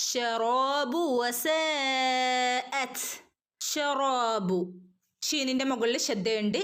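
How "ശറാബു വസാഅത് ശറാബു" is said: slow chanted ശറാബു; heavy ഷ, vowel held slow; tajweed recitation. Shown as a demonstration. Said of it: "ഒന്ന് കനപ്പിച്ചു കൊടുക്കുക". (കനപ്പിച്ച് ഓതുക)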